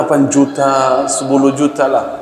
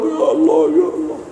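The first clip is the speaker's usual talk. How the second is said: much higher, wailing ya Allah with a mock sob; crying out in prayer; 13 semitones higher than usual. A man in the mosque crying out to God, wailing. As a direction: cry ya Allah as if weeping, voice breaking upward. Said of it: "suaranya teriak".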